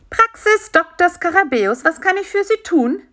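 friendly phone voice. A narrator's reading. Doctor's practice answers the telephone, friendly high voice. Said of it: "fragte eine freundliche Stimme".

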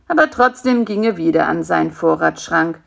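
plain reading voice

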